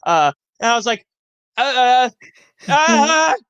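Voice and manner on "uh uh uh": mock pained whimpering. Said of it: "didn't cry this time".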